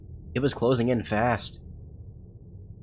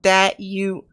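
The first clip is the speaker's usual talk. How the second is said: strained character voice; 10 semitones higher, 7 dB louder than usual. Acted. Character voice with ghost.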